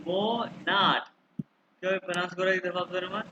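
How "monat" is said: मोनाट in two long syllables, "moo-naat"; German मोनाट, long o, long a; pronunciation model. Shown as a demonstration. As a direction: draw out मोनाट slowly for the learner. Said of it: "इसको pronounce करो".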